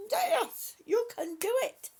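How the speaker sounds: loud, high cheer of encouragement; root for them with everything; excited cheer. Real, not acted. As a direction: shout it out like a cheerleader. Cheering on a struggling viewer.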